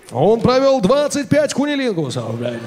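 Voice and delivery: booming arena announcer voice. Retold or imitated. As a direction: announce it like a fight intro. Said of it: "ринг-анонсер".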